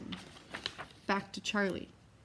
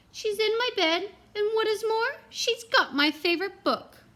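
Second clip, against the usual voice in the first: much higher little bear voice, louder; Baby Bear; 13 semitones higher, 6 dB louder than usual. Acted. Baby Bear tells on Goldilocks, high small-bear voice. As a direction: squeak it like a tiny bear cub. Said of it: "Baby Bear said".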